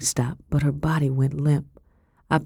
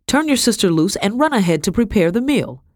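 curt command; 6 semitones higher than usual. A narrator's reading. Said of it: "she snapped".

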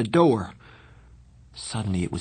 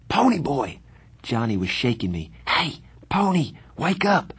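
higher-pitched call to rouse a sleeper; urgent waking call; 5 semitones higher than usual. A narrator's reading. Johnny shaking a sleeping Pony awake, urgent call. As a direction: call out to wake a friend.

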